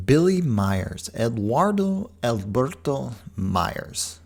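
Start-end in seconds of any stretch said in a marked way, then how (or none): 1.1-4.2 slow, rolled-out Spanish accent on the full name. Shown as a demonstration. Giving the guest's full name, in a Spanish accent. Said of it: "I didn't mean to add a Spanish accent to that".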